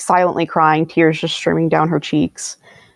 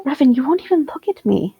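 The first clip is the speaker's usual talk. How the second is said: higher-pitched, hurt appeal; hurt pleading; 6 semitones higher than usual. Acted. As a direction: plead with him for an answer. Acted plea to avoiding Revan.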